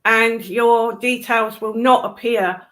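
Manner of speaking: ordinary talk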